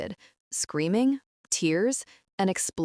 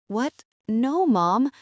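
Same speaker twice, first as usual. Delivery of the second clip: higher and louder, caught off guard; surprised protest; 5 semitones higher, 5 dB louder, slower than usual. A narrator's reading. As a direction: blurt it out in disbelief. Daughter stunned by mother's apology.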